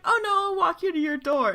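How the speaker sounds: high, quick imitation of the boy; insistent teenage date. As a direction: pipe up eagerly as the retold date.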